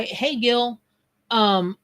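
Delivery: normal talking voice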